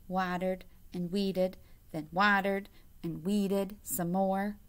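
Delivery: plain reading voice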